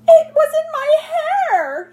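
high, loud, slow panicked shriek; a woman shrieking over her hair; shrieking shout. Acted. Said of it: "she shouted".

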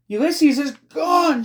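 very high, slow, loud wail; scream. A narrator's reading. Girl telling friend Ulysses is gone, screaming. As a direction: scream the news in desperation. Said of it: "she screamed".

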